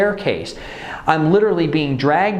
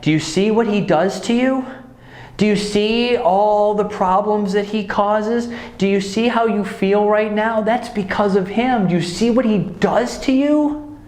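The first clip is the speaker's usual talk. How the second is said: high, far-off coaxing voice of the relatives, much higher; manipulative relatives; 8 semitones higher than usual. Retold or imitated. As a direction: mimic the relatives pushing the child, pitched up high. Relatives pressuring the child.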